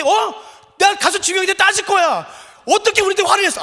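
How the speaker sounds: loud, high, aggrieved protest; indignant complaint. Retold or imitated. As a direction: act out the young staffer's outrage. Colleague quoted, still upset.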